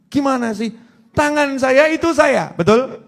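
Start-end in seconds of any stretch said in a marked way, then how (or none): none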